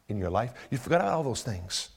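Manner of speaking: normal talking voice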